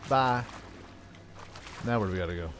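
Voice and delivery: ordinary delivery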